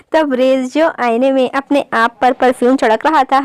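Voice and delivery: ordinary delivery, nothing marked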